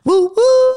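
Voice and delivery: high loud woo woo hoot; train whistle hoot. Shown as a demonstration. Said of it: "That's my normal woo woo".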